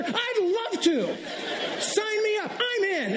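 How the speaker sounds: high, stretched-out eager yes; over-the-top cheerful sign-up; enthusiastic yes. Retold or imitated. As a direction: gush it like you can't wait to give. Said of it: "say yes with enthusiasm".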